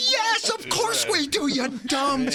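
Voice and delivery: hammed-up boss shouting at the dumb intern; mock shout. Intern boss Joe yelling at the naive intern, mock shout. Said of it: "He goes".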